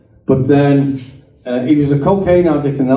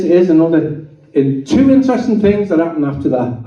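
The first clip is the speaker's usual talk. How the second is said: ordinary delivery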